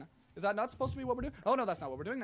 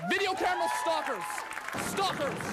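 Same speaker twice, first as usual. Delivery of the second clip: hammed-up outcry; mock yell; 7 semitones higher than usual. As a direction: call out the stalkers in mock alarm. Host mock-yells about stalkers.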